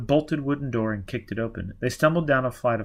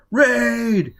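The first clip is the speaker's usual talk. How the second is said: much higher, loud warning cry; alarmed shout; 12 semitones higher, 7 dB louder than usual. Acted. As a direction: yell it across the hospital. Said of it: "Baker shouted".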